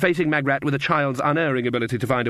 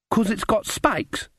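put-on little girl voice; 9 semitones higher, slower than usual. A narrator's reading.

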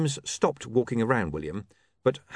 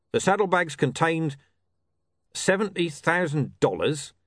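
pitched-up, official police-report voice; watch commander reporting; 6 semitones higher, 3 dB louder than usual. Acted. Commander reads evidence from his notebook, official report tone. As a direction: recite the case notes like an officer.

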